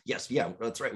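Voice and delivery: usual speaking voice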